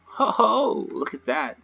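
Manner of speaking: ordinary talk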